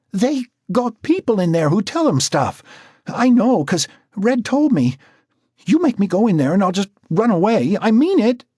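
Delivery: put-on high, loud, panicky girl's voice; frightened young woman. Marcy begging Jack not to send her, high and panicky.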